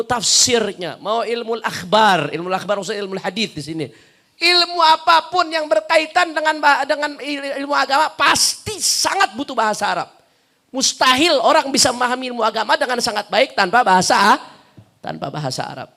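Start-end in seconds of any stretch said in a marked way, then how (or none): 4.4-14.4 raised, high-pitched preaching voice; insistent lecturer at full force; emphatic preaching. Real, not acted. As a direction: drive the point home forcefully.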